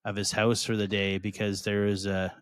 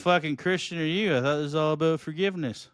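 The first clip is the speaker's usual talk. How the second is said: higher, fast mock indignation; mock outrage; 7 semitones higher, faster than usual. Friend mocking the preacher who banned Dave, fast and indignant. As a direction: fire it off like a mocking challenge.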